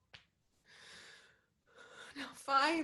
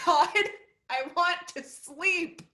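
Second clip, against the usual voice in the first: desperate plea; 6 semitones higher, 12 dB louder than usual. Acted. Molly crying out that she wants to sleep, desperate. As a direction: stretch it out in desperation. Exhausted monologue after panic.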